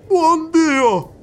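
high, loud cry of alarm; a soldier realising he's at a séance; shocked exclamation. Acted. Soldier exclaiming in alarm, high and loud. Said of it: "esclamò".

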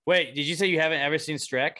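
ordinary talk, nothing marked

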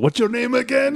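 high-pitched acted question; mock friendly question. Joking about the name tag on the coat, high mock voice. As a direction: pitch it way up, playfully.

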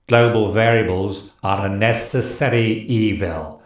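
slow, sinister villain voice; evil voice. Pretend. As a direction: menace it out like a villain. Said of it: "that's my evil voice".

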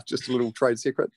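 usual speaking voice

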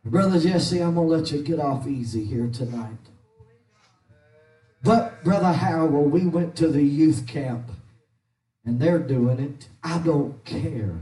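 ordinary talk

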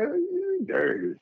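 high, slow playful scold; mock scolding. Man teasing his girlfriend for hiding the license, slow and high. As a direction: drag it out, teasing. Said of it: "I was like".

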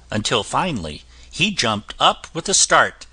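ordinary delivery

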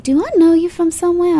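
party guest, sung up high; high Southern voice. Retold or imitated. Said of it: "I talked real high like this".

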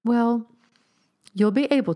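tart comeback starting high; retort, pitch high on the opening word. A narrator's reading. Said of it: "retorted Miss Kite".

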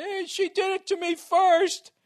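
very high, slow whiny protest; complaining to the referee, high and whiny; mock whine. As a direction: whine like a kid to the ref. Whining to the refs.

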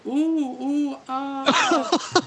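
high, loud mock moaning; imitated porno moans. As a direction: moan in hammed-up movie passion. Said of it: "she's going".

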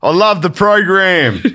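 high, loud broad Australian mate; hammed-up bogan Australian testimonial; full Australian. Bob praising the weight-loss program, full-on Australian. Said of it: "We went full Australian there".

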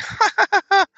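sudden high burst of laughter. Real, not acted. Host laughing at his McDonald's joke, high laugh. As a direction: crack up at your own joke.

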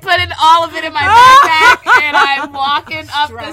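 mock struggling meme girl; meme voice, strained. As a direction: act out hauling the load upstairs. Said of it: "The girl with the backpack".

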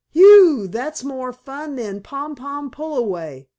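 a loud, high "Phew" then panting words; out of breath from chasing tumbleweeds; puffing. A narrator's reading. Boy puffing after a wild romp in the wind, breathless and happy. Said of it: "puffed Lige".

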